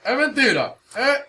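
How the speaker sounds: Rochi's tone. Retold or imitated. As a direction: drop it like a street guy. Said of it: "Con el tono de Rochi".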